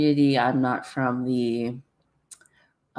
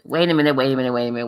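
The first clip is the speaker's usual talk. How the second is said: ordinary talk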